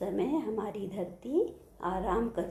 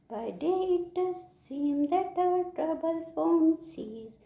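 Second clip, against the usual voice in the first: high, loud chant dropping on the last word; poem recitation; 8 semitones higher, 4 dB louder, much slower than usual. Shown as a demonstration. Teacher reciting the poem for children, high slow chant. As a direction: chant high and slow, then drop to close. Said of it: "I am going to recite the poem".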